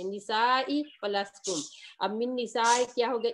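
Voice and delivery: ordinary talk, nothing marked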